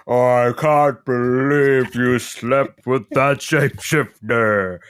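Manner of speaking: put-on jealous girlfriend voice. Voicing a jealous Starlight.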